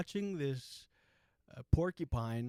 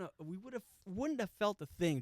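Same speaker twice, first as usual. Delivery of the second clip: ordinary talk, nothing marked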